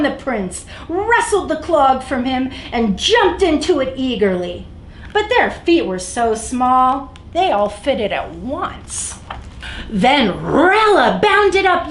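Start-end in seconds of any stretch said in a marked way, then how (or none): none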